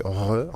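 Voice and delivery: "R" made in the throat, the French way; French guttural R. Shown as a demonstration. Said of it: "en français, ce n'est pas du tout le même son".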